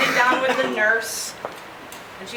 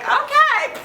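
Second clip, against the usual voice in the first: chirpy doctor; 14 semitones higher than usual. Retold or imitated.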